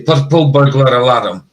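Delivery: "purple burglar alarm", every R rolling; rolled R's. Shown as a demonstration. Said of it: "roll our R's".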